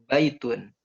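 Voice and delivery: BAI-tun, short falling ending; stress on first syllable. Shown as a demonstration. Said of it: "Ba-nya ditekan".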